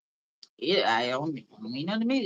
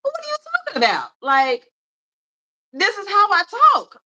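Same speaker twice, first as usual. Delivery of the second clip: much higher, fast disbelief; incredulous self; 9 semitones higher, faster than usual. Retold or imitated. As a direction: act out her own baffled reply, pitched way up and quick. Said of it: "And I'm like".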